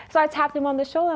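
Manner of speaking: ordinary talk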